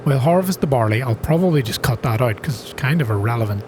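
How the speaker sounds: ordinary talk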